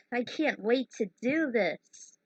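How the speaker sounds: usual speaking voice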